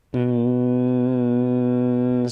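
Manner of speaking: long steady hum, tongue pressed to the roof of the mouth; hummed N sound. Shown as a demonstration. Breathing teacher shows the class the N exhale, long steady hum. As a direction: let the breath out on one even hum. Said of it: "I'm making the N sound".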